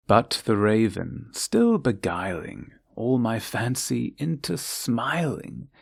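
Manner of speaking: ordinary delivery, nothing marked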